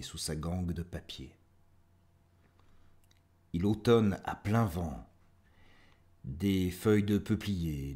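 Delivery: measured recital, pausing after each line; poetry reading. Acted. Host reading listeners the librarian poem, measured poetry recital. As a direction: recite verse phrase by phrase, letting endings fall.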